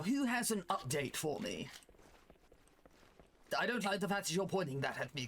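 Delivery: hammed-up jolly old British officer; British gentleman officer. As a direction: address the troops like a genteel British commander. Plays British officer in game.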